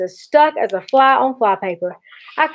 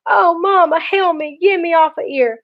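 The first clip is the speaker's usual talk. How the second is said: high mock-wail of a stuck boy; desperate wailing; 8 semitones higher than usual. A narrator's reading. Stuck brother calls Mama for rescue, desperate wailing. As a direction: plead in a high, helpless cry.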